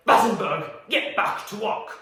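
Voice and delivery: loud impression of a bossy chef; yelling boss. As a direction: shout an order like the boss. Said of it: "That was an impression of my boss".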